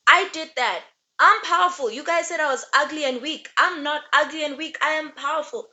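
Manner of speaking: loud, high, triumphant boast; boastful proud shout. Retold or imitated. Cersei boasting to those who called her weak, loud and proud. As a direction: crow it like she's the most powerful.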